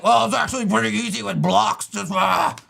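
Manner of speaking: loud, mocking caveman voice; web design caveman. Mocking a Twitter commenter's easy answer, loud caveman voice. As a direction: blurt it like a dim caveman, ending in a grunt. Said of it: "like a web design caveman".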